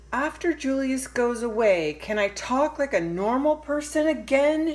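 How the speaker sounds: a fed-up little sister shouting at her parents; shouting child. Acted. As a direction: yell it out in frustration. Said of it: "she shouted".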